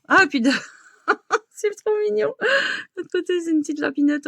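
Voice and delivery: high laughing squeal over something cute; squealing with delight. Real, not acted. Gushing over cute gift.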